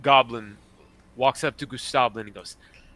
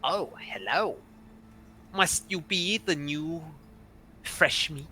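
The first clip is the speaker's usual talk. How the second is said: lightly put-on goblin voice, lilting; slightly slow, lilting goblin; lightly voiced goblin; 4 semitones higher, 7 dB quieter, slower than usual. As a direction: goblin greeting newcomers, drawn out. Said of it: "he walks in and goes".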